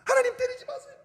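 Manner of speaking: high, pleading cry not to be hit; scared plea. Retold or imitated. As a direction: beg like a frightened child. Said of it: "제가 이러는 거예요". (맞을까 겁먹은 아이처럼 높게 애원)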